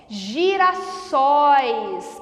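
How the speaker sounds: "Girassóis" drawn out as gi-ra-SÓIS; syllable by syllable. Shown as a demonstration. Teacher showing the stressed ending, slow and stretched. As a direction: sound out "Girassóis" slowly, long open ó. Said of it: "oxítona, terminada em ois".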